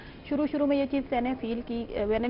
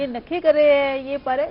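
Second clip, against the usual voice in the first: put-on slow, louder cooing baby talk; drawn-out babyish lisp, pitched up; 6 dB louder, slower than usual. Shown as a demonstration. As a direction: demonstrate how parents coo at little ones. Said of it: "تو وہ جب ہم ایسے بولتے ہیں نا".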